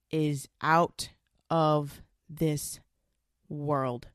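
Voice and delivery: slow, drawn-out oh my God; slow reverent exclaim. Real, not acted. Telling viewers about pregnancy sex, slow. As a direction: savour each word, very slowly.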